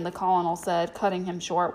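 ordinary delivery

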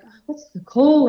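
high, loud mock agonizing over "call"; hammed-up urgent pleading; pressured self-questioning. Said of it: "put a lot of pressure on myself".

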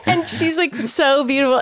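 ordinary delivery

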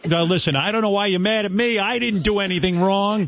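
high-pitched wounded protest; high, whiny-style mock defence. Mock pleading with angry caller.

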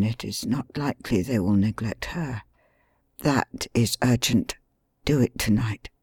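hushed bedridden plotting; weak injured man. Acted. Injured Holmes, faint voice.

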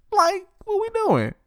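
a high, disbelieving "what we doing"; high-pitched incredulous cry; exasperated. Real, not acted. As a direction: squeal it in disbelief. Exasperated aside.

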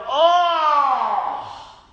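loud, high cry of pain; long, hammed-up agonised "oh"; imitated pained groan. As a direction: act out sudden stomach cramps. Imitating man with stomach cramps.

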